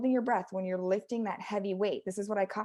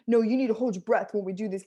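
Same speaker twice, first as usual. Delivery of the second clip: loud bro-coach voice; 5 dB louder, faster than usual. Acted. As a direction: push it out like an insistent gym bro. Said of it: "it's like my bro voice".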